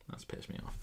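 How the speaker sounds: voiceless whisper to yourself; hushed, whispered grumble; annoyed whisper. Real, not acted. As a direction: whisper the complaint under your breath.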